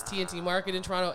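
ordinary talk

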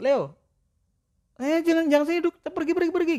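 very high, fussy shooing of a cat. Retold or imitated. Owner shooing his cat off his seat, high and fussy.

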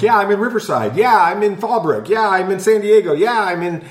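playing the callers chiming in; mock chorus of listeners.

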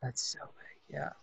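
breathless whisper, barely voiced; overwhelmed whisper. Real, not acted. The client, overwhelmed by the healing moment, whispers. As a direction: whisper it as it sinks in, stunned.

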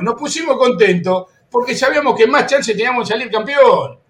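usual speaking voice

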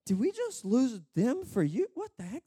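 high, baffled outburst, pitch swinging; mock dismay. Angels bemoaning losing spirits for a thief, mock dismay. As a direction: splutter in comic disbelief. Archangels react to swap of spirits.

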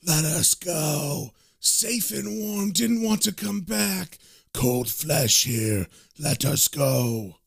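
eerie, stretched-out corpse voices; screaming corpses. Acted. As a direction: moan it out like reanimated dead pleading for release. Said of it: "they begin to scream".

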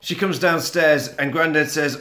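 normal talking voice

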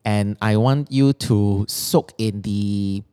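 usual speaking voice

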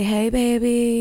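slow, lightly imitated pop star. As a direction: stretch out a celebrity's phone greeting. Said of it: "I heard her say".

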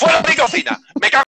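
loud, high furious chef shout; grito de chef furioso. Pretend. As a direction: yell like a furious head chef. Imita a un chef gritando. (grito de chef furioso)

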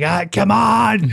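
high, slow, laughing come on; goading him, half laughing; retold dare to fight; mock taunt. Retold taunting a wife-beater.

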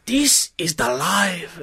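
slow, contented sigh of a line; content man impression. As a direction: savor it slowly. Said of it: "that's my best impersonation".

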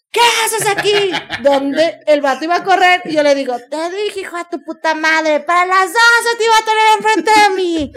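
high, loud, threatening yell; menacing shout. Retold or imitated. Woman taunting the scared guy, loud and menacing. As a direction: roar the threat at him. Her dramatic possessed entrance.

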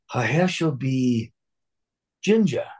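drawn-out line, then a high-pitched punchline; comic theatrical reveal. Retold or imitated. Student improvising on Shakespeare for a laughing audience, comic reveal. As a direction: hold the suspense, then pop the last word high.

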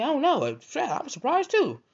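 very high, loud bluffing squeal; feigned surprise. Retold or imitated. Man caught making up excuses, high feigned surprise. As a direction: bluff it high, badly faked astonishment.

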